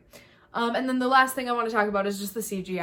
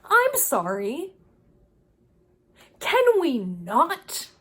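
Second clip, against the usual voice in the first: a slow, louder, higher sorry Richie; imitating Richie; 4 semitones higher, 5 dB louder, much slower than usual. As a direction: drag out an embarrassed put-down. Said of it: "and he's like".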